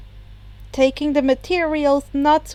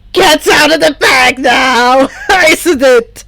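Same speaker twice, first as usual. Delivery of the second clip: louder, laughing through a smirk; 10 dB louder than usual. Acted. Toya laughing that the secret is out. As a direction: gloat while cracking up. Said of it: "Toya burst out laughing".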